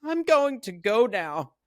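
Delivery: wife telling her trapped husband she is leaving, mock timid; high timid wife voice. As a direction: squeak it out like a frightened wife. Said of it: "she's like".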